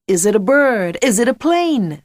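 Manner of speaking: loud American superhero-show announcer; hammed-up American accent, excited. A narrator's reading. Jack mocking the headteacher's Superman costume, American accent. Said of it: "in his favourite American accent".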